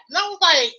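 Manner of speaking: ordinary delivery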